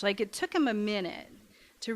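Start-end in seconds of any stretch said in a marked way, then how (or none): none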